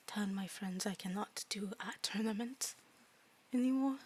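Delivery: soft, halting fairy with broken English; small, tentative fairy student voice; timid fairy student. Acted. Plays a fairy student in game.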